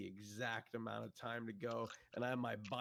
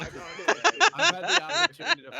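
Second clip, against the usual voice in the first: high-pitched laughing talk; 17 dB louder than usual. Real, not acted. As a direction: laugh through the words. Laughing reply to teasing.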